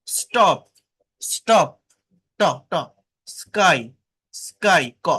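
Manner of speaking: t in "স্টপ" and k in "স্কাই" without a puff; carefully isolated words, dry t and k in "স্টপ", "স্কাই"; non-aspirated stops. Shown as a demonstration. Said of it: "এগুলো নন aspirated".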